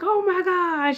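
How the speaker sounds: high, loud thrilled oh my gosh; mom overjoyed. Retold or imitated. Mom learning about the twins, high excited gasp. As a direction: gush it out in delight.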